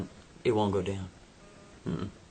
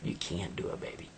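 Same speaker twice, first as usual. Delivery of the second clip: whispered plea; 4 semitones lower, 7 dB quieter than usual. Acted.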